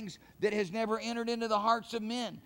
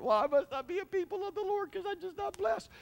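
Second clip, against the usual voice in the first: mock doubter; 11 semitones higher than usual. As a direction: voice the self-pitying believer lightly. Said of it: "That's your problem".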